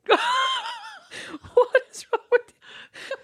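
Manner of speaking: very high, slow squeal through laughter; helpless laughing mock exasperation. Imagined kidnapper to hopeless captive, squealing with laughter. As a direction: play the fed-up captor while cracking up.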